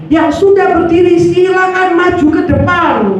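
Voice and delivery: imitating a preacher's loud, high altar call; pendeta. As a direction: project a pulpit summons to the congregation. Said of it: "ngomong lagi pendetanya". (menirukan seruan lantang pendeta)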